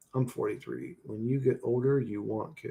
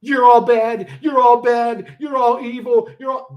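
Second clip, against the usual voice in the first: high, loud, repetitive ranting; constant accuser; 9 semitones higher, 7 dB louder than usual. Acted. Imitating someone who constantly blames everybody, loud accuser. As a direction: hammer out each mock accusation. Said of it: "a person that's constantly saying".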